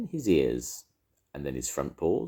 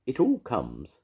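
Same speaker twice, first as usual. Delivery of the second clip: higher, mildly cross grumble; 8 semitones higher, slower than usual. A narrator's reading. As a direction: complain crossly. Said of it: "said Pooh crossly".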